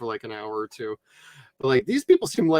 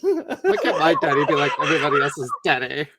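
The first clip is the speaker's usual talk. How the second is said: put-on Valley Girl accent. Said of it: "laid into the Valley accents".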